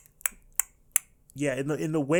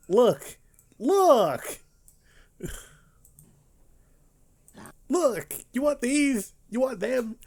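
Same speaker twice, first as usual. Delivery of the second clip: much higher taunting villain voice; mock villain; 10 semitones higher, slower than usual. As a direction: play the sneering bad guy offering the kids. Mocking a villain over the children.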